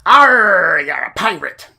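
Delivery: loud, growled pirate "Arr". Acted. Game master showing a pirate voice, loud and growly. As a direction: roar it like a hammy pirate. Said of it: "like a pirate and talk with".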